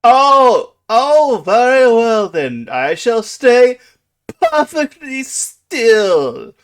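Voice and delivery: very loud, high theatrical declaration; grand booming dragon. Acted. Dragon agrees to stay put and pose, booming theatrically. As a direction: proclaim it pompously, stretching the last words.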